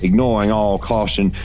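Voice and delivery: usual speaking voice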